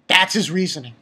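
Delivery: ordinary talk, nothing marked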